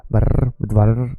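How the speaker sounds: var ending in an exaggerated trill; hard rolled R. Shown as a demonstration. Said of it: "just make the R soft".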